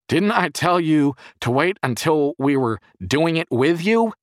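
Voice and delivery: loud, high-pitched angry scolding; furious yell. A narrator's reading. Jerry scolding the boy by the fallen car, furious yell. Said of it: "he yelled at the boy in fury".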